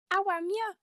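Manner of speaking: "How am ya?" in a high, loud Brummie accent; Birmingham accent. Shown as a demonstration. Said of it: "can you both do the Birmingham accent".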